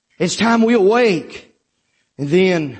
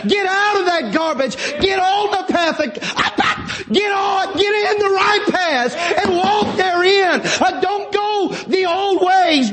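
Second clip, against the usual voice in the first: preaching at full force; 9 semitones higher than usual. Acted. Preacher urging the church to leave the past, shouting high. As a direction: thunder it at the congregation.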